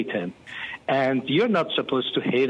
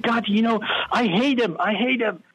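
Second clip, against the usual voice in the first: retold bitter outburst; angry prayer; 8 semitones higher than usual. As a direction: spit out the hatred to God.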